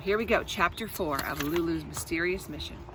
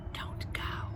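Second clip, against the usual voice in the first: breathless unvoiced whisper; hushed desperate whisper; 11 dB quieter than usual. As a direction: mouth it secretly so nobody hears.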